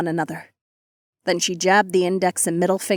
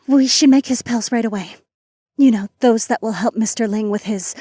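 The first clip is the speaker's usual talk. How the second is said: trembling voice; 3 semitones higher, 5 dB louder than usual. Acted. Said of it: "to steady her trembling voice".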